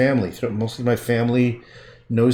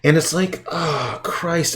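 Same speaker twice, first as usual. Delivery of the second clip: usual speaking voice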